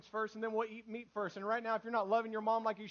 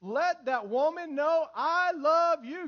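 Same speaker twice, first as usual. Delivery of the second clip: slow, high and loud; emphatic exhortation; 6 semitones higher, 8 dB louder, slower than usual. Real, not acted. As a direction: preach it out, stretching every word. Exhorts husbands at full voice.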